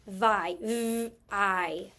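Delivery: VI, then a held V and a long I; sounding out. Shown as a demonstration. Teacher showing a long vowel, sound by sound. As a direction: segment VI into V and long I. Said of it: "long vowel in the syllable".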